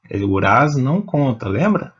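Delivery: usual speaking voice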